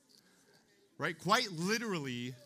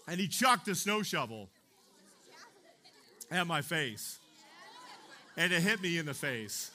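ordinary talk

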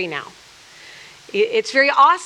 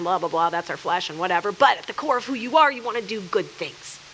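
ordinary talk, nothing marked

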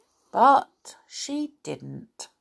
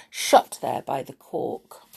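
plain reading voice

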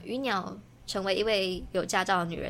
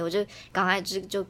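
ordinary delivery

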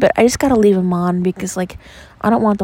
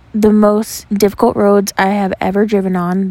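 ordinary delivery

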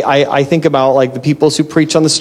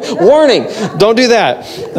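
slow, higher, laughing warning; laughing mock warning; 6 semitones higher, slower than usual. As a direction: warn them jokingly through a laugh.

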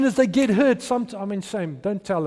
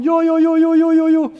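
loud, high celebratory chant-like shout; exuberant shouting; 9 semitones higher, 10 dB louder than usual. Retold or imitated. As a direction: shout for joy, voice pitched way up. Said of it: "started shouting".

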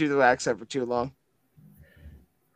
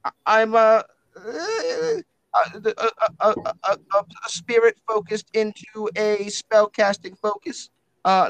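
higher, halting, matey spirit-book voice; blokey talking book feeling for words; Winston the blokey spirit book; 7 semitones higher, 4 dB louder, slower than usual. Acted.

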